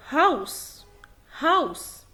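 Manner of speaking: "هاوس" with a clear H at the start, said slowly; sounded initial H. Shown as a demonstration. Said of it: "لو الها جت في أول الكلام بتنطق ها". (نطق "هاوس" بهاء واضحة في الأول)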